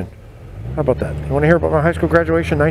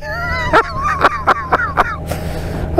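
very high real laughing fit; helpless laughter. Real, not acted. Storyteller remembering his friend's prank, high helpless laughter. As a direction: lose it completely laughing. Cracks up naming the heckler.

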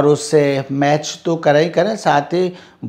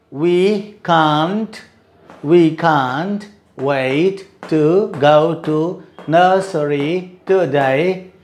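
slow model line; much slower than usual. Shown as a demonstration. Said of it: "Can't becomes can".

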